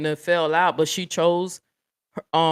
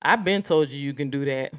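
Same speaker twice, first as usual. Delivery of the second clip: normal talking voice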